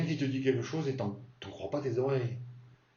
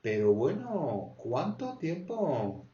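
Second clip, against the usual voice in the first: slow, higher delighted greeting; happy reunion; 4 semitones higher, slower than usual. Shown as a demonstration. Meeting someone after ages, slow delighted. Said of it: "tu peux dire aussi".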